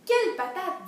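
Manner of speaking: high, loud storybook outburst; amazed exclamation. Acted. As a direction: exclaim as the astonished farmer.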